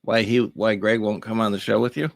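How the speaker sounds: ordinary delivery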